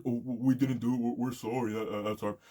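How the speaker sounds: mock apology. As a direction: lightly parody a library excuse. Mocking the library's apology.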